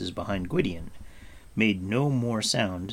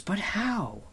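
much higher, puzzled question; surprised; 11 semitones higher than usual. A narrator's reading. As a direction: ask it taken aback. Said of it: "Taran asked with surprise".

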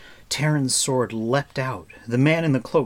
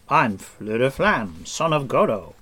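courtly introduction; 2 semitones higher, 5 dB louder than usual. A narrator's reading. Bard introducing himself to Taran with a bow, mildly grand. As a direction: announce yourself lightly like a bard. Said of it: "bowing deeply".

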